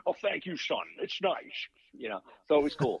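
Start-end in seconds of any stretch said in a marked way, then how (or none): 0.1-1.4 high Scottish film-star impression; put-on Scottish voice, pitched up; Sean Connery. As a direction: thank him as a kindly Scottish screen legend. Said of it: "Mister Connery".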